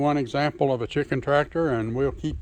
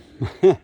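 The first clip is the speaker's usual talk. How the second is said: short laugh; 8 semitones higher, 9 dB louder than usual. Real, not acted. Laughs at his own slip.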